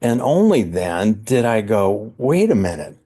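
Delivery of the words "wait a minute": higher-pitched startled questioning, stumbling over the words; retold puzzlement. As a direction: recount a baffled double take, pitch jumping up. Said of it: "sitting there just puzzled and".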